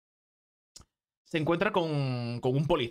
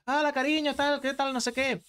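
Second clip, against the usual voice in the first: high-pitched mock spouse hello; higher, chatty imitation of a wife; cheery wife greeting; 9 semitones higher than usual. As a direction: greet your husband in a high put-on voice. Voices wife greeting husband at door.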